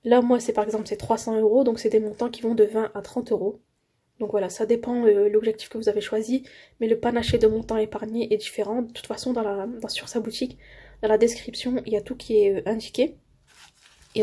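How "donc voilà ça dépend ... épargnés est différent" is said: ordinary delivery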